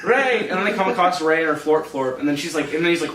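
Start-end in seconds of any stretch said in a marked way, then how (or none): 0.0-0.5 high mock call for a missing friend; acted searching call, not a full shout. Poe calling out searching for Ray.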